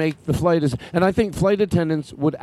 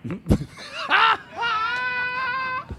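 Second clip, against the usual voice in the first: wild laughing shout; 4 dB quieter than usual. Pretend. Mock pilot crying laugh.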